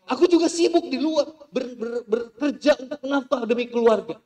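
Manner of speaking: high, very loud shout; husband yells he works for the family; husband shouting back. Acted. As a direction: fire back at her. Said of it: "Kata suaminya".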